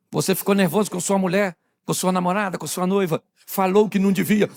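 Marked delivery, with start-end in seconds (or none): none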